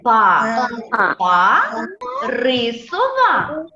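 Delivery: slow, separated syllables, last words stretched; drawn-out rhythmic repeat-after-me; tongue-twister drill. Shown as a demonstration. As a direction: lead a class chanting the rhyme slowly. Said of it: "Давайте повторимо".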